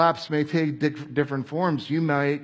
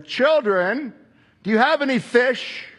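higher, louder call across the water; Jesus calling out; 10 semitones higher, 4 dB louder than usual. Acted. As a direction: call out kindly to the boat.

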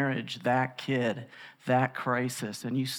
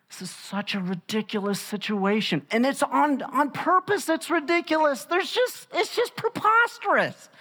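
rising incredulity; 9 semitones higher than usual. Real, not acted. Preacher building incredulity.

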